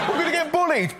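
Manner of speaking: mock little girl. Little girl with man hand dreading school, high scared voice.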